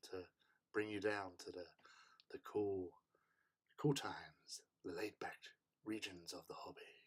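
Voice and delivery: soft, low, hushed ASMR delivery. Pretend. Streamer calming an unwell viewer, ASMR voice. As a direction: lull them down gently, almost whispering. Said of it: "S R voice on for you".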